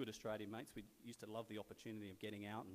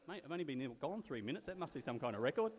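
louder, higher punchline in a friend's voice; raised voice, building to a loud finish; mock-amazed mate; 5 semitones higher, 8 dB louder than usual. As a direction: call it out like an impressed mate. Retold mate's punchline on returning.

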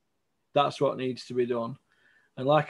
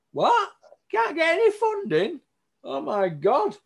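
mock disbelief; 13 semitones higher, 4 dB louder than usual. Mock disbelief at funding refusal.

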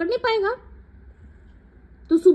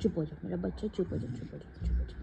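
much lower and softer, a tender hush; calm the little one down gently; soothing hush; 12 semitones lower, 14 dB quieter than usual. Real, not acted. Mother hushing her scolded pet, tender and soft.

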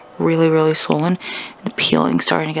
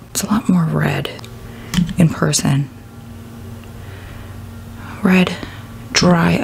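whispering. Real, not acted. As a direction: whisper it softly. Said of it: "I'm being quiet".

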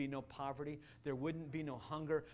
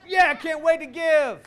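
much higher, loud whooping laugh and yell; showing the congregation excited giving; 10 semitones higher, 15 dB louder than usual. Shown as a demonstration. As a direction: cheer like a giddy giver.

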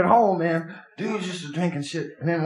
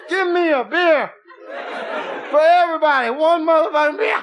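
put-on rowdy drunk shout; 9 semitones higher than usual. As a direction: holler like the drunk buying rounds. Drunk shouting buying rounds.

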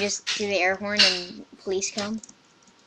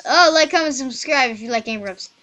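mock plug; 2 semitones higher, 7 dB louder than usual. YouTuber interrupting own story, loud mock plug. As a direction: burst out with a channel plug, then settle.

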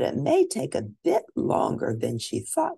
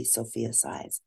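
usual speaking voice

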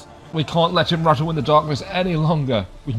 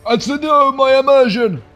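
silly put-on voice; 12 semitones higher, 9 dB louder than usual. Putting on a silly voice.

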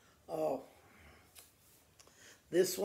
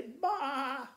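a laughing sheep bleat; a sheep's baa with a laugh in it; 5 semitones higher than usual. Shown as a demonstration. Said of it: "when the sheep says, say".

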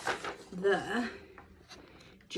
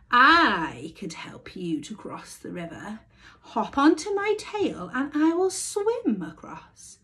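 cunning fox; 4 semitones higher, 6 dB louder than usual. A narrator's reading. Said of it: "offered the cunning fox".

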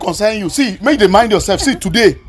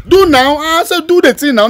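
taunting shout; 7 semitones higher than usual. Acted. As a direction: goad him to try it. Taunting him to act during quarrel.

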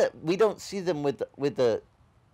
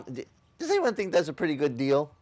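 usual speaking voice

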